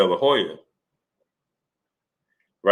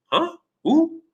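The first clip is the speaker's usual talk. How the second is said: high, puzzled "Huh? Who?"; imitating Manny. Host imitates Manny not remembering an undercard fighter, puzzled high. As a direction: sound lost and clueless, pitched up.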